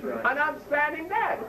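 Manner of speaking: ordinary talk, nothing marked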